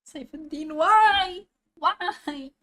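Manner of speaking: high-pitched plea, loud on the first why; dismayed wail. Real, not acted. Viewer reacting to the suspect's name, dismayed wail. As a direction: cry out in disbelief at the name.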